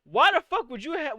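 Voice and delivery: ordinary delivery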